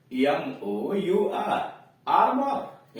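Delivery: spelling out letters then saying armour; अ-ध्वनि वाला 'आर्मर'; spelled then said. Shown as a demonstration. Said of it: "यहां देख रहे हैं, ओयू नहीं हो रहा है". ('आर्मर' को अ की ध्वनि से बोलना)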